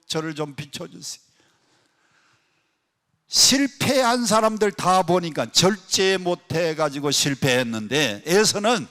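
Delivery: ordinary delivery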